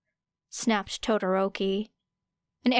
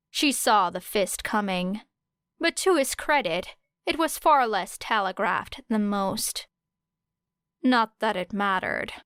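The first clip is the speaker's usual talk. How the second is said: ordinary delivery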